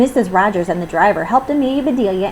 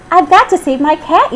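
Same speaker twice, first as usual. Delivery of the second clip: higher, urgent yell from up the tree; urgent yell while climbing; 9 semitones higher, 5 dB louder than usual. A narrator's reading. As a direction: call it out, desperate to reach the cat. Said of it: "yelled Amelia Bedelia".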